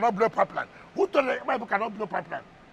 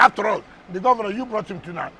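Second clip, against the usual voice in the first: ordinary delivery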